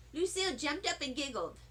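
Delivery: ordinary delivery, nothing marked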